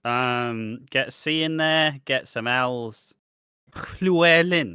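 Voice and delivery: mock Welsh name-maker. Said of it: "it's like the Welsh".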